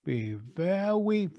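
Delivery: slow, soft start of a cartoon hunter's line; Elmer Fudd impression. Shown as a demonstration. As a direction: creep in like a hushed cartoon hunter. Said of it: "here's Elmer Fudd".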